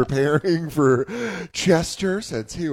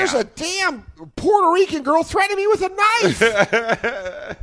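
higher put-on old man voice; mock old man; 12 semitones higher than usual. Riffing on the video, mock fussy old man.

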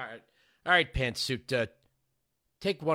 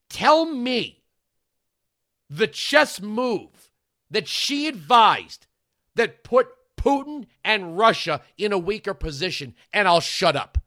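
higher and louder, slow and hammering; ranting shout; 6 semitones higher, 7 dB louder, slower than usual. Real, not acted. Host shouting a challenge at his listeners, ranting. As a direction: demand an answer, pounding each phrase.

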